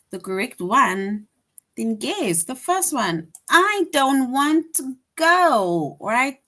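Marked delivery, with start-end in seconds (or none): 3.5-6.0 louder, slow, emphatic refusal; strong exclamation. Shown as a demonstration. Teacher showing the exclamation answer, loud slow refusal. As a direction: say it with strong feeling. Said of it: "that is an exclamation".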